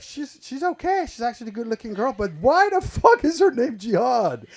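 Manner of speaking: high, puzzled mock disbelief; incredulous inner voice. Looking at her dating profile, baffled. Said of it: "I'm like".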